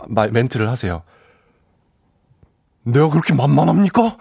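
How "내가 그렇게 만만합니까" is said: Park Hae-il line. Retold or imitated. DJ doing a talent-show movie line for listeners, lightly acted. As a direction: deliver it like a wounded movie line, half-serious. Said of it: "박해일 씨가 거기서 이제 딱 탕웨이 씨를 보고 이런".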